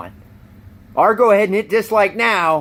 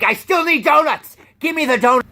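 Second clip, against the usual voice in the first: robber demand; 9 semitones higher than usual. Retold or imitated. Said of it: "he's like".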